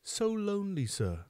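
put-on high, mournful child's voice; crying. A narrator's reading. Orphan confessing loneliness to the beadle, acted crying. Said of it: "cried the child".

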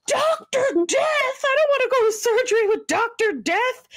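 hammed-up high-pitched dismay; mock horror. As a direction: imitate a panicked patient refusing. Lydia alarmed at surgeon's name.

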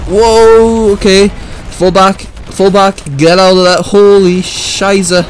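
high, loud, frantic orders; panicked shouting. Real, not acted. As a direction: yell fall back in a panic. Grenade blast, pulling troops back.

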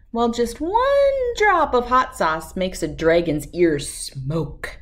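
plain reading voice

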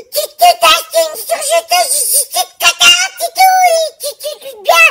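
high, loud, squealing with joy; thrilled shouting. Acted. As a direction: shout with delight at the gift. Thrilled at surprise machine.

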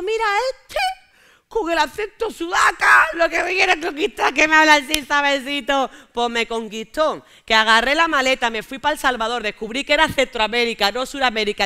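high, loud excited telling. Real, not acted. Telling the audience about her Salvadoran man, excited and loud.

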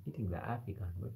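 quick, soft Kenyan accent. Shown as a demonstration. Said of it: "I'm speaking like a Kenyan".

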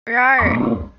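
loud playful monster roar; huge fiery dragon roar. Pretend. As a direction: growl it out like a beast. Roaring for Pokemon in battle.

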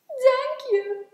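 high, loud small-character voice; little Klein. A narrator's reading. Klein thanking Groot for the helping hand, piping up. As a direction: pipe up high. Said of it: "zei Klein".

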